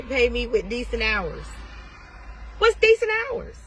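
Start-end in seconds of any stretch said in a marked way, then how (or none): 2.6-3.6 high, pointed challenge; impatient mentor cutting in; sharp raised question. Real, not acted. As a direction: fire it back, higher and sharper. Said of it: "Stop yelling at me".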